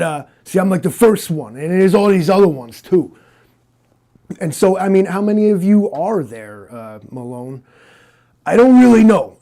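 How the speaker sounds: tough detective bragging. Acted. Interviewer plays Malone bragging to Rook, brash and loud. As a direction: swagger over your sidekick.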